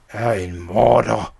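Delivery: slow, hoarse, swelling to loud; hoarse accusation. A narrator's reading. Poisoned Schnickels accusing the child of murder, hoarse voice. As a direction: rasp it out slowly, building on the last word. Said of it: "sagde han hæst".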